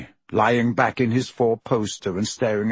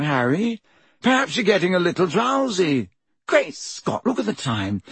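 puzzled breezy Lockhart; 5 semitones higher than usual. Acted. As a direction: brush off the boy's fear cheerfully.

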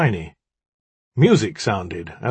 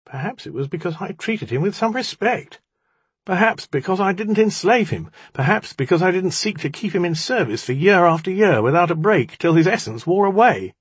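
heated, higher-pitched reproach; accusing outburst; 8 semitones higher than usual. A narrator's reading. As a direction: pile up the accusations, rising in force. Kitty's accusing outburst in dialogue.

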